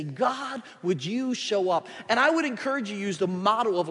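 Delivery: loud, driving pulpit preaching; preaching at full force. Acted. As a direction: push it out to the whole congregation.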